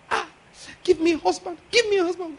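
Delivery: high, soft mock plea; imitated pleading prayer. Preacher imitates person praying for a husband, soft pleading. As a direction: mimic a small, high-pitched begging prayer. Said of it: "as the person is praying".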